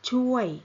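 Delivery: chuoi said with a falling Thai tone. Shown as a demonstration. Teacher models chuoi with a falling tone for students. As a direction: let chuoi fall in pitch. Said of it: "falling tone".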